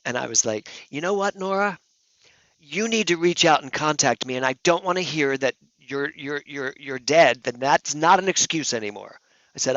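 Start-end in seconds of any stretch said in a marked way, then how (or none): 0.9-9.2 higher-pitched, insistent scolding; worked-up mock tirade; retold angry rant. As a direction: re-enact demanding an answer from someone absent. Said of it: "What are you screaming about".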